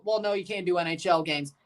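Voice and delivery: ordinary delivery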